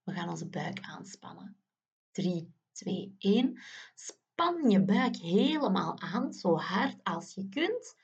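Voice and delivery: normal talking voice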